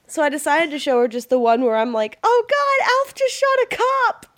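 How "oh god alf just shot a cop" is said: high shaken alarm; panicked horror. Retold or imitated. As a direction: gasp it out in horror. Quoting her podcast freakout.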